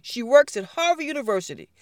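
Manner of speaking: ordinary delivery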